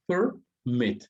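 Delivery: "permit" partido en dos sílabas separadas; dividido en sílabas. Shown as a demonstration. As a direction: separa "permit": per, pausa, mit. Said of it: "tiene dos sílabas". ("permit" pronunciado sílaba por sílaba, con pausa)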